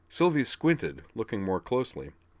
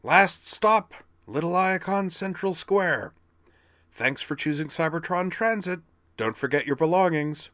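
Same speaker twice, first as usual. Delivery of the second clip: much higher, rasping robot announcer; 10 semitones higher, 5 dB louder than usual. Acted. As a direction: rasp it out high and loud like a transit announcer. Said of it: "in a high, rasping voice".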